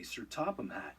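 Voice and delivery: plain reading voice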